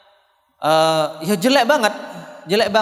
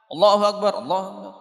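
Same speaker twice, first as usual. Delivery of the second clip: rushed prayer; 2 semitones higher than usual. Shown as a demonstration. Teacher mimicking prayer without tumakninah, hurried takbirs. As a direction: rattle off both takbirs with no stillness. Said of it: "kalau tidak tumakninah bagaimana?".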